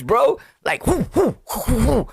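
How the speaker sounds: ordinary delivery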